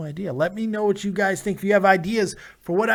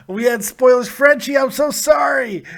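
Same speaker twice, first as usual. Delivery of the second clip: loud apology; 6 semitones higher, 6 dB louder than usual. Real, not acted. Host apologizes loudly for spoilers.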